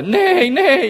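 high, loud joking neigh; whinny like a cartoon horse; mock neigh. Shown as a demonstration. Preacher jokes through a horse neigh. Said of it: "I'm really bad with animal sounds".

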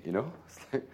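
usual speaking voice

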